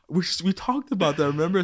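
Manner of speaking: a touch of a mom sweetly reminding her son. Retold or imitated. Mom sweetly reminding her son about the gift, mock sweet and high. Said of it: "She's trying to be sweet".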